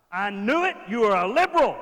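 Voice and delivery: loud, high triumphant gotcha; gotcha accusation. Pretend. Elders catching Jesus out, loud and high triumphant. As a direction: pounce on him like you caught him. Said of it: "You don't believe in the Bible".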